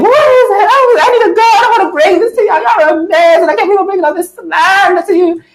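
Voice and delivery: hammed-up high panicked voice; panicked high-pitched self. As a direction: squeal it out like you're freaking out. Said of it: "really emotional".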